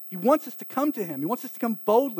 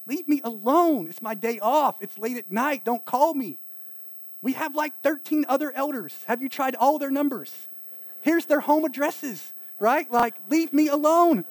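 higher-pitched, fed-up protest; acted exasperation; 6 semitones higher, 4 dB louder than usual. Pastor to late-night caller, exasperated brush-off. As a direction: push the pestering caller away, voice raised in pitch. Acts out pet peeve at callers.

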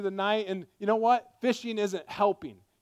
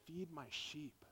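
tender hush; 4 semitones lower, 20 dB quieter, slower than usual. Acted. Jesus speaks softly to Peter.

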